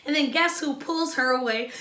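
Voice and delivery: usual speaking voice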